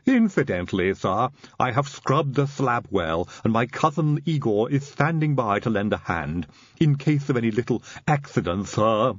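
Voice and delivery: odd servant voice, measured and deferential; Igor. Acted. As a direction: report dutifully to the master in character. Igor's sustained servant voice.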